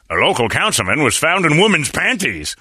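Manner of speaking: lightly voiced dramatic anchor; mock newsman tease. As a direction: read it like a lurid promo. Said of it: "he just looks at you and goes".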